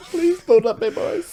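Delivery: pleading mock coward. Imitating his friend's scared face at the fight offer, mock pleading. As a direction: beg like a scared wee boy. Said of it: "fanny face going down that road of".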